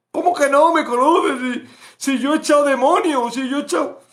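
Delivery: high, loud outraged disbelief; rejected believer's high, loud outraged protest; indignant protest. Acted. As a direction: protest your good deeds. Believer protesting, demons cast out.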